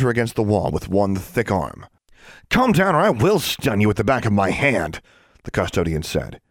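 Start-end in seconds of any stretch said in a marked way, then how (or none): 2.5-5.0 raised, higher-pitched scolding; stern threat. A narrator's reading. Custodian pinning a struggling girl, threatening. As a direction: warn forcefully, voice pitched up.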